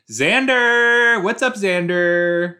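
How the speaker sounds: high, loud, drawn-out greeting; excited calling out. Real, not acted. Fan greets Xander on screen, excited holler. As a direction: holler the name and stretch it out.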